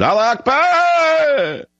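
high, long drawn-out mock scream; imitated scream. Host mimics a Somali man on a plane, screaming. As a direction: stretch the last syllable out in a high cry.

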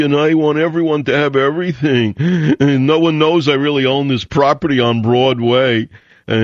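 mocking put-on voice of a two-faced poet; phony poet's act. Fake poet fooling people about his wealth, mocking big act. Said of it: "who puts on a big act".

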